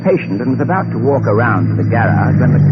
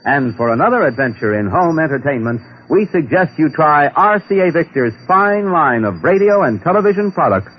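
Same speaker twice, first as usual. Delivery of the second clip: bright, higher radio announcer pitch; announcer read; 8 semitones higher than usual. Acted. Radio announcer presents the show and sponsor to listeners, polished ad read. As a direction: sell the sponsor like a classic radio host.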